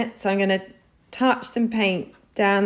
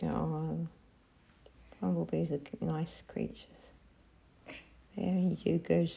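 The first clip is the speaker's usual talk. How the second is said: talking around a paintbrush in the mouth; words blocked by a brush held in the mouth; 10 dB quieter than usual. Real, not acted. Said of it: "I got a paintbrush in my mouth".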